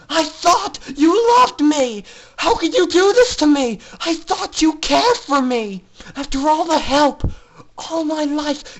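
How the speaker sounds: high, loud tearful pleading; filly sobs and yells at her big sister; crying and shouting. Acted. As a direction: shout through tears at a betrayer. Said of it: "from the crying and shouting".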